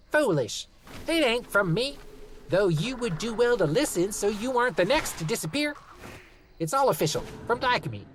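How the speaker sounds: clipped voice of the post office man; voiced postal clerk; gruff clerk voice. A narrator's reading. Postal clerk warns Linus.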